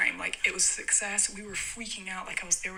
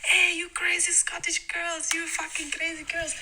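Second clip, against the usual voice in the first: high, slow mock Russian holler; Russian guys calling out; 10 semitones higher, 4 dB louder than usual. Russian guys greeting girls in car park, high mock-Russian call. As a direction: call out in a put-on Russian accent. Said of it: "they start being like".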